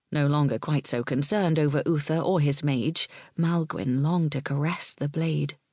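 usual speaking voice

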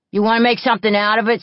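fast, loud, challenging; picking a fight. A narrator's reading. Bully challenges July, fast and loud. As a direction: rush at her looking for a fight.

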